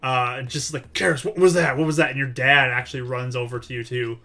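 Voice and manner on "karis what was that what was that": loud, urgent and high; alarmed shout. Acted. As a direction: call out in alarm. James runs in alarmed.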